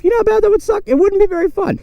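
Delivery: cartoonish high squeak; squeaky high voice. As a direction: jump way up into a squeaky falsetto. Squeaky voice on a bad crash.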